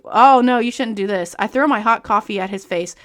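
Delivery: usual speaking voice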